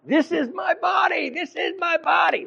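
hammed-up furious Luther yelling; shouting the same words over and over; Luther screaming. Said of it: "Luther screaming over and over to Zwingli".

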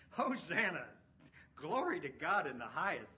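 shouted praise. Acted. Rocks crying out praise.

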